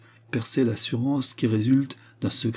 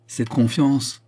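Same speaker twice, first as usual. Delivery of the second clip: raised, louder scornful echo; crushing contempt; 6 semitones higher, 6 dB louder, much slower than usual. A narrator's reading. Queen repeats her visitor's word, crushingly contemptuous. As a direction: throw the word back with disdain. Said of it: "plus écrasante encore du mépris".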